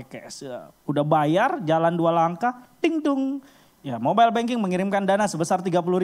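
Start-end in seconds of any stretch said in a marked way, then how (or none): 2.8-3.4 imitated notification chime. Bank notification arrives.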